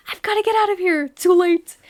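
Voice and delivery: high, loud cartoon panic; SpongeBob panic quote. Host quoting SpongeBob while joking about fleeing, high cartoon panic. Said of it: "Like that episode of SpongeBob".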